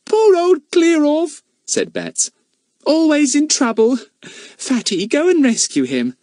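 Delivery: put-on high, pitying little girl. A narrator's reading. Bets pities Goon and sends Fatty to help, teasing.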